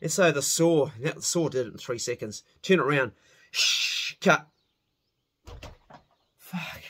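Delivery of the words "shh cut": saw sound. Retold or imitated. Imitating the saw cutting.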